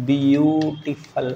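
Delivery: "beautiful" stretched very slowly; drawn-out word. Shown as a demonstration. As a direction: linger on every syllable of "beautiful". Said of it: "तो more".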